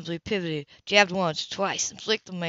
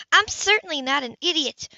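higher, a bit louder indignant woman; 8 semitones higher, 4 dB louder than usual. Acted. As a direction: bristle back at him, offended. Grace indignant.

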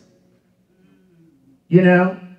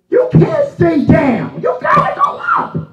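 preacher shout; 10 semitones higher than usual. Acted.

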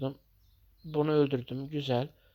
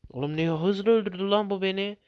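shocked outburst; 5 semitones higher, 4 dB louder than usual. Real, not acted. Killed fast, shocked outburst.

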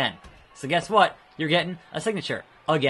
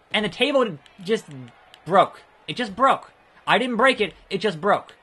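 higher, louder outraged protest; indignant; 6 semitones higher, 4 dB louder than usual. Real, not acted. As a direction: protest in disbelief. Indignant at broken table.

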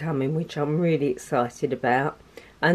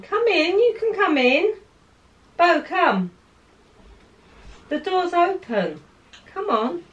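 high sing-song call, much higher and louder; high, loud call to a pet; calling the dog; 13 semitones higher, 6 dB louder than usual. Real, not acted. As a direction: coax the dog through the open door.

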